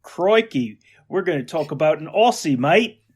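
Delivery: hammed-up Aussie greeting; playful stock-Aussie accent; Australian accent. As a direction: put on a broad Australian accent. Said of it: "you talk with your Australian accent".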